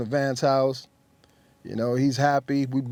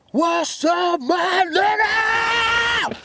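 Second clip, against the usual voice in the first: excited greeting; much slower than usual. Retold or imitated. Old friend answering the page, high drawn-out shouted greeting. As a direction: call out like you're thrilled to hear him.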